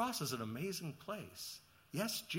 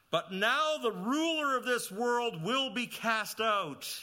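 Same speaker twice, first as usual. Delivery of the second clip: slow, higher and louder proclamation; preaching emphasis; 5 semitones higher, 6 dB louder, slower than usual. Acted. Preacher announcing Satan's defeat, slow and loud. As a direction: declare it with weight.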